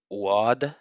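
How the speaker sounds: lightly Arabic-accented oud. Shown as a demonstration. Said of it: "I'm doing an accent".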